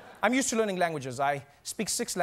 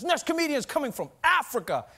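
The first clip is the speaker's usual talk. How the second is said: higher-pitched stage introduction; hyped show announcer; 8 semitones higher, 3 dB louder than usual. Retold or imitated. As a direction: announce the act, pushing up the pitch. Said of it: "bring me out like this".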